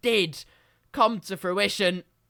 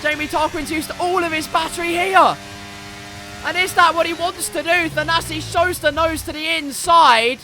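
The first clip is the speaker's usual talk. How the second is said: keyed-up, higher-pitched live commentary; race play-by-play; 7 semitones higher, 7 dB louder than usual. Real, not acted. Commentator calling the last lap of the Grand Prix, tense play-by-play. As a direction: build tension through the last lap.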